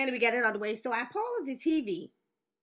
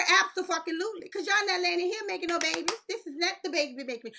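defiant mom; 8 semitones higher than usual. Retold or imitated. Mom scolds son.

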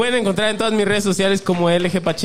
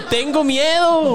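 mock fearful confession; 8 semitones higher, much slower than usual. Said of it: "Les dije".

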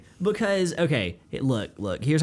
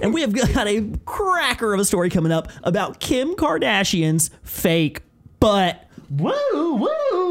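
morning radio host; 6 semitones higher than usual. Shown as a demonstration. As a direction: tease the story like a wacky DJ. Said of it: "morning radio host".

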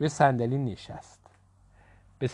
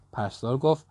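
ordinary delivery, nothing marked

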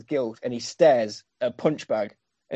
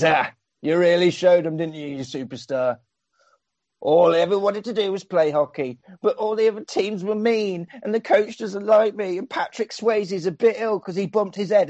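mocking whiny brother; 5 semitones higher than usual. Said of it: "He says".

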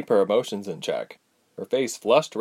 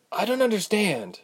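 voice cracking; 11 semitones higher than usual. Acted. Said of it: "her voice cracked".

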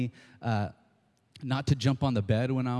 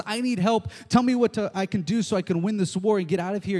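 higher, pleading king asking for help; pleading Saul; 7 semitones higher, 3 dB louder, faster than usual. Acted. Desperate Saul begging Samuel for help. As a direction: beg for help, a little breathless. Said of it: "And Saul says".

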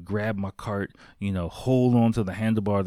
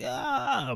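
imitated high-pitched kid's "ah"; freak-out wail. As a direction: mimic a child in a panic. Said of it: "He starts freaking out".

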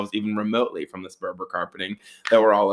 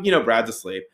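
ordinary talk

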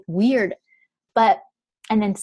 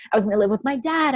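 ordinary talk

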